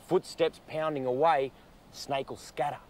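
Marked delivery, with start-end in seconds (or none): none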